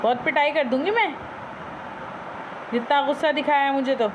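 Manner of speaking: pretend-stern owner scolding her parrot; mock scolding. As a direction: tell off the pet in mock anger, half teasing.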